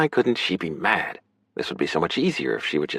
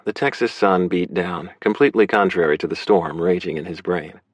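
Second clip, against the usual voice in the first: plain reading voice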